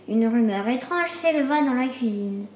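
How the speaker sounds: ordinary delivery, nothing marked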